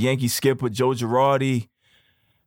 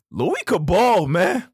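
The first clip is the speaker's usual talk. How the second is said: emphatic praise; 5 semitones higher, slower than usual. Real, not acted. Praising an old Yankee's skill.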